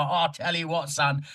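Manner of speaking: mock a thick Cockney pipe-up; a touch of mocking Cockney; Cockney geezer. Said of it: "It's very Cockney".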